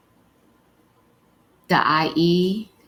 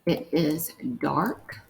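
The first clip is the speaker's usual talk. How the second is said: word-by-word dictation; slower than usual. Shown as a demonstration. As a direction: dictate piece by piece with spelling. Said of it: "The last one we're gonna write is".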